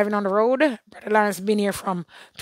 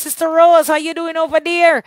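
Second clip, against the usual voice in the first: high, raised greeting; calling out; 7 semitones higher, 6 dB louder than usual. Real, not acted. Host hails Sister Rose over there, calling out. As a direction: hail her loudly from afar. Hailing a viewer by name.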